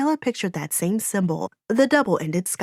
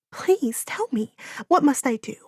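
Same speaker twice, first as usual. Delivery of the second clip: put-on pleading girl; 6 semitones higher than usual. A narrator's reading. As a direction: beg for the answer. Gabriella begging.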